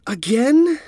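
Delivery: high cracking whine. A narrator's reading. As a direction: let the voice crack in protest. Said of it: "His voice cracked".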